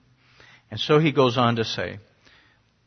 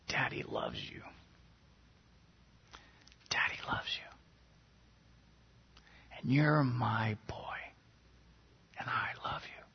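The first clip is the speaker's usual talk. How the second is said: hushed, unhurried whisper with long pauses; tender whisper; 10 dB quieter than usual. Retold or imitated. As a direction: whisper it into a crying little boy's ear, soothing him. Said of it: "I start whispering in his ear".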